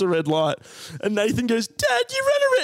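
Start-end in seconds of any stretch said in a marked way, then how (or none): 1.7-2.6 put-on high shouted little-kid voice; high-pitched kid yelling at dad; kid tattling. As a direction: squeal it like a tattling child. Kid brother tattling in story.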